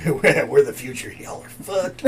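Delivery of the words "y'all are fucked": laughing. Real, not acted. Laughing joke to listeners.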